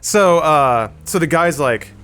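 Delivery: ordinary talk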